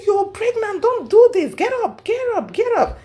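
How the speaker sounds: high, pressing "get up"; urgent pleading. Retold or imitated. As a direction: coax her off the floor, urgently. Urging crying pregnant friend up.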